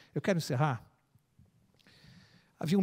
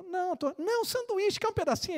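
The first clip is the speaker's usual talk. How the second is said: put-on higher child voice, eager and stumbling; excited little boy; 13 semitones higher than usual. Little boy gushing to the old man on the bench. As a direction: gush like a starstruck kid.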